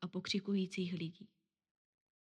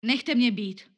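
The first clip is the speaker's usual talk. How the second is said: loud, higher, curt brush-off; grumpy snap; 4 semitones higher, 10 dB louder, slower than usual. Acted. Poor man snaps at newspaper girl, loud and curt. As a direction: snap it to make her go away. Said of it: "odsekl nevrle".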